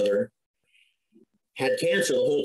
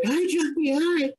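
a lightly voiced, happy, much higher "Hi, Jumpy, hi"; Sharon's greeting. The dead coworker greets him in the vision, lightly voiced and high. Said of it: "she's like".

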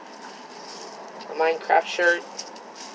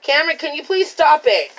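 exasperated; 8 semitones higher, 4 dB louder than usual. Real, not acted. Mom scolding son again.

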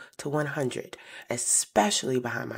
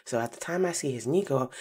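ordinary delivery

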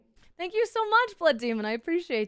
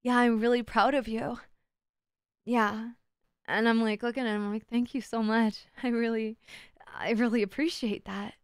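tender; 3 semitones lower, 4 dB quieter than usual. Retold or imitated. Intimate gaze with instructor.

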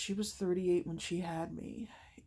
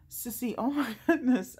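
excited laughing call; 6 semitones higher, 7 dB louder than usual. Retold or imitated. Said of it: "and I said".

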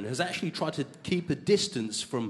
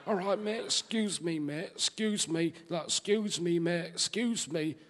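quietly polite Northern English mate; Northern English accent; 6 dB quieter than usual. Retold or imitated. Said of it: "in the North, they say things like".

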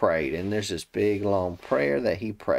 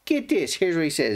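ordinary delivery

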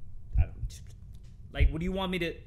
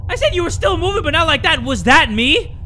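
loud, high, alarmed protest; rattled shouting; 11 semitones higher, 9 dB louder than usual. Real, not acted. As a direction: protest loudly, still jolted by the scare. Rattled right after jump scare.

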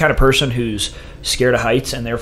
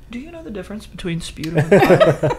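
innocent sincere question; 8 semitones higher, 4 dB louder than usual. Retold or imitated. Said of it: "true and non-sarcastic and innocent".

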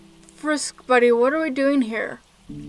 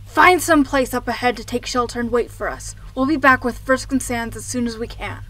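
ordinary talk, nothing marked

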